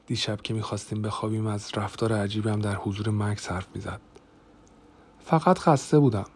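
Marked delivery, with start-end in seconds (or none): 5.2-6.2 higher, louder self-justifying voice; defensive excuse. A narrator's reading. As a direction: brush off the question defensively. Defends herself to husband. (با لحن تدافعی و صدای بالاتر)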